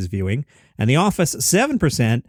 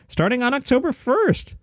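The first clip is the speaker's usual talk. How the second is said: lightly hammed-up irate comedian, rising high; a hint of worked-up stand-up comic; angry Jerry Seinfeld; 9 semitones higher than usual. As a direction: pitch it up into a mock-angry comic exclamation. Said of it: "I'm gonna slip into Angry Jerry here".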